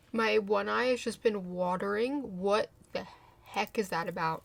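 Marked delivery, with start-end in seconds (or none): none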